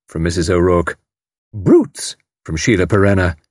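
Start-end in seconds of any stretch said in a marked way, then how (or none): 1.5-2.2 outraged. A narrator's reading. Sheila outraged at kidnappers.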